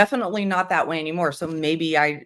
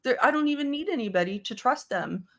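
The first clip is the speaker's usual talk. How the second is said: higher, shrugging don't-care tone; breezy dismissive attitude; 7 semitones higher than usual. Retold or imitated. Younger self shrugging off trusting people, lightly dismissive. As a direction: brush off the need to trust anyone. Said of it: "I had that attitude".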